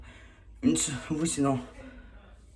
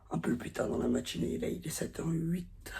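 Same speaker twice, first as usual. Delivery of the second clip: whispering; 4 semitones higher, 5 dB quieter than usual. Real, not acted. Early morning hushed voice.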